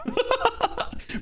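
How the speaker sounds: a quiet, high falling giggle; high laugh. Real, not acted. Fan amused by his own chant, light high laugh.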